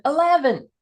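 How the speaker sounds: Scot's put-on American accent, pitched high; attempted American accent. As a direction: try the word in an exaggerated American accent. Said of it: "they try an American accent".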